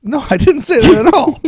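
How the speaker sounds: very high, loud protest; indignant denial. Real, not acted. Denies accusation of racism, much higher.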